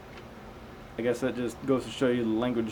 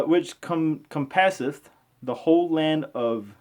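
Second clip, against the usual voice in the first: raised, slow Bible reading voice; slow, measured scripture reading; 5 semitones higher, slower than usual. Acted. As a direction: read it out like a careful lesson.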